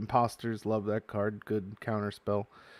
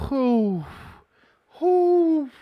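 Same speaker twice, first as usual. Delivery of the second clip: loud, high whoop, the second much higher; celebratory whoop; 8 dB louder than usual. Real, not acted. Cheering a foil pull for viewers, loud high whoops. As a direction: whoop like you hit the jackpot.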